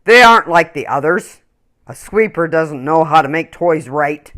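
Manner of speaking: loud, scornful factory boss; loud dismissive owner; stern owner. Acted. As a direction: scold like a harsh boss.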